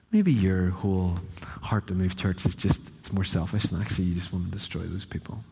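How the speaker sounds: soft, low, insinuating voice; hushed, low coaxing with lingering pauses; subtle tempter. Acted. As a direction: plant doubts gently, posing as reasonable counsel. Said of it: "Instead, it's a bit more like".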